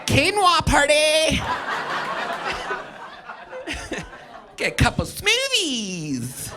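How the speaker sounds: high, slow mock hipster; trendy hipster voice. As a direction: ham up a quinoa-loving hipster. Mocking trendy New Yorkers.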